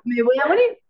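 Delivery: retold dread, voice rising at the end; rising, half-joking panicked quote; mock panic. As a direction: replay a dramatic fear of dying, lightly hammed-up. Retells own panic about tetanus.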